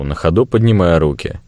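ordinary delivery, nothing marked

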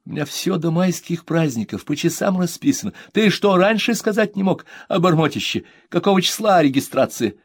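mother scolding, high and loud; exasperated mother. Acted. Mother to son, exasperated scolding.

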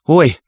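короткий высокий вскрик «ой»; вскрикни коротко от схватки. A narrator's reading. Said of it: "вскрикнула мать". (громкий короткий вскрик боли)

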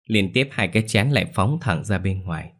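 plain reading voice

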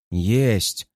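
terse low snap of a commander; curt cut-off. Acted. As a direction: shut him down in one word. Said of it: "прерывает командир". (резко обрывая)

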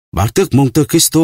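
high, loud gasp of recognition; shocked cry. Acted. Prisoner recognizes the unmasked count, shocked outcry. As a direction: blurt the name out in shock. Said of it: "Đăng La kêu lên".

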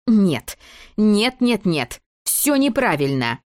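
громко, высоко, с досадой; оборвать всех нетерпеливым «нет»; раздражённо. Acted. Дебора прерывает репетицию. (раздражённо и громко)

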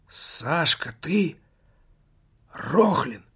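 a mild shocked cry of recognition; shocked exclamation. A narrator's reading. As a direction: gasp out his name in disbelief. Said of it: "потрясённо воскликнула Юнникова". (потрясённый возглас)

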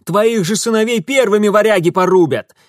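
high, loud retort; angry bark. A narrator's reading. Mitya warns the old woman about her sons, angry bark. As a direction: hurl the warning at her. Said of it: "рявкнул он".

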